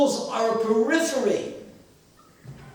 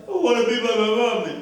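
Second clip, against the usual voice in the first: quick, high mock wail; imitated childish crying; crying little boy; 4 semitones higher, 4 dB louder, faster than usual. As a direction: whine like a tearful child wanting a parent. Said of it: "the kid starts crying".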